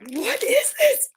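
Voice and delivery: high, slow, awed and tearful; amazed gasp. Real, not acted. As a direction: marvel at the gift, near tears. Unboxing a gift, moved.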